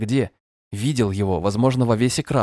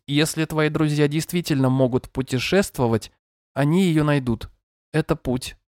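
gentle but monotone synthetic voice, higher; screen reader voice; 6 semitones higher than usual. Acted. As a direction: read it like a calm machine voice. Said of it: "звучал ласково, но монотонно".

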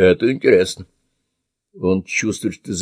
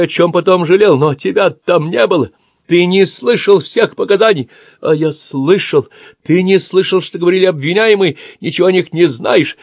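panicked pleading; 8 semitones higher, 4 dB louder than usual. Acted. Old man pleading under assault.

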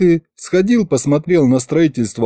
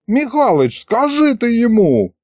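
whining complaint; 10 semitones higher than usual. Acted. Dragon head complains to Mikhalych.

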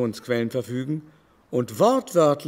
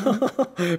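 amused laugh; 7 semitones higher than usual. Acted. Baron laughs at the question.

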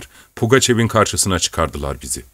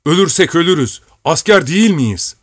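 defiant shout; 7 semitones higher than usual. A narrator's reading. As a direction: rouse them with pride. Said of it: "diye haykırdı".

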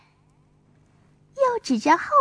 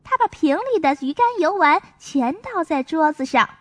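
ordinary delivery, nothing marked

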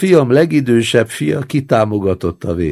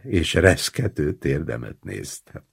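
plain reading voice

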